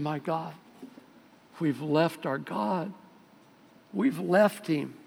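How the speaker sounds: slow, soft lament; mildly tearful, drawing the words out; acted tearful grief. King hears the lost scripture and grieves, mildly tearful. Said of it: "I imagine he started crying".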